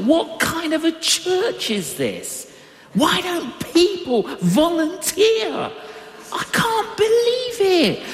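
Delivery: high, incredulous mock outrage at the church; indignant inner voice. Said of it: "And I thought".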